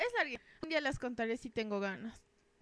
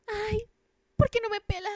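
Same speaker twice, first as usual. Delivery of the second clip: lovesick whine; 10 semitones higher, 4 dB louder than usual. Retold or imitated. Lovesick girl whining.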